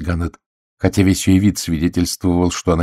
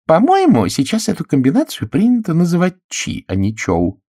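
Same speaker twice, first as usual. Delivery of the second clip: prim correction; 11 semitones higher than usual. A narrator's reading. Miss Gannett corrects Caroline, high and prim. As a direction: set her straight, primly. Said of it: "поправила мисс Ганнет".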